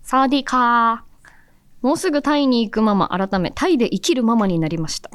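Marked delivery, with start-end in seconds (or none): 0.0-1.9 a slow, sing-song Thai hello; Thai greeting. Retold or imitated. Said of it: "宮地さん、". (タイ語の挨拶をゆっくり抑揚をつけて)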